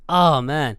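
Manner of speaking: normal talking voice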